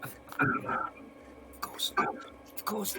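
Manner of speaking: slow breathy rasp; croaky whisper. Acted. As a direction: wheeze the words out. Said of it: "croaky voice".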